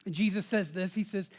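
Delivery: usual speaking voice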